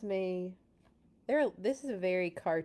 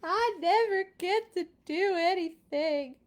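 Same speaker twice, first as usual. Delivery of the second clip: higher, louder whiny complaint; pigeon whining; 8 semitones higher, 5 dB louder than usual. Acted. Pigeon complaining to the reader, whiny and raised. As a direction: whine like a sulking kid.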